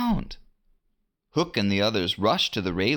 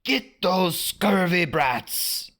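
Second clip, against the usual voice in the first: pirate order; 10 semitones higher, slower than usual. A narrator's reading. Hook ordering crew.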